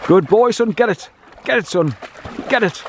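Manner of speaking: high, eager calls to fetch; egging on the dog. Real, not acted. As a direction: urge the dog after the stone in a lifted voice. Urging dog to fetch stones.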